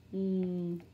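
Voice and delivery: N as a held nasal "ng" as in sink; letter sound. Shown as a demonstration. Teacher drilling letter sounds, N as "ng". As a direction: hum N as "nng". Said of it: "N, sink".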